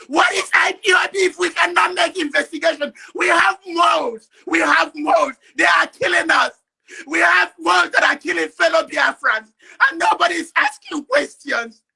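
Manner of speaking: loud, hammering accusations; real fury, pressing the charges home; angry shouting. Real, not acted. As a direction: shout down everyone listening. Protest rant at full force.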